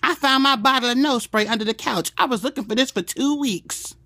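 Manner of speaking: thrilled about a small find; giddy excitement. Retold or imitated. Person explaining why they screamed, giddy. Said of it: "And they like".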